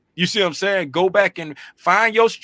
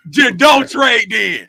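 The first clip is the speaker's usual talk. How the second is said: laughing; 5 semitones higher, 6 dB louder, slower than usual. Real, not acted. Joking quote with laughter.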